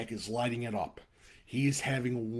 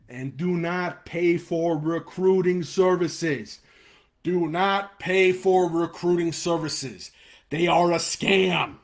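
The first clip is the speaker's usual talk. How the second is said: slow, louder, hammering each word; heated, emphatic plea; passionate rant; 3 semitones higher, 6 dB louder, slower than usual. Real, not acted. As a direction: drive the warning home hard. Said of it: "one thing I'm passionate about".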